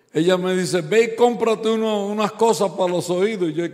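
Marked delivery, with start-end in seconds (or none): 0.8-3.5 raised voice. Retold or imitated. Wife tells him buy hearing aids.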